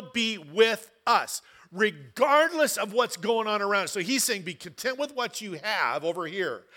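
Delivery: loud preaching, slowing to stretch each promise; impassioned preaching. Acted. Pastor assuring the congregation God stays near, loud and slowing. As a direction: build it up, then lean hard on every word.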